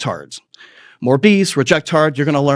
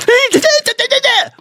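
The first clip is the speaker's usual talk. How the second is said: very high cartoon squeal; high squeaky impression sound. Mimicking the prosecutor with a high squeal. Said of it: "she was like".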